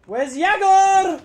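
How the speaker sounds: high, drawn-out call; urgent calling out. Real, not acted. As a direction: call slowly across to a missing teammate. Calling for a teammate.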